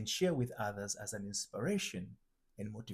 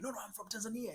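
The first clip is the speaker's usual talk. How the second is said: mock argument; 10 semitones higher, 6 dB quieter, much faster than usual. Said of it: "we can probably argue".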